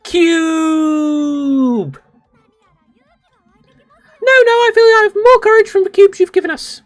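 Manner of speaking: put-on very high, loud grateful girl; a shrill cartoon thanks; high squeaky anime girl. Thanking for the cubes, very high squeaky girl voice.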